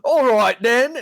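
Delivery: put-on high, loud cheeky British accent; playful over-the-top British accent; mock British accent. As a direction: ham up a cheery British accent. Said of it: "When you say straight Britain".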